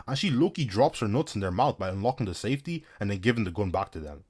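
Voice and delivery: ordinary talk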